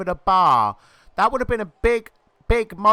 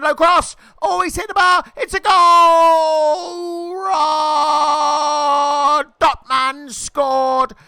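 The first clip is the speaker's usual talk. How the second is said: louder and higher, yelling as the ball goes in; excited goal shout; 8 semitones higher, 6 dB louder, much slower than usual. Real, not acted. Commentator roaring at a goal as it happens, excited shout. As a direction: shout the goal like the crowd just erupted.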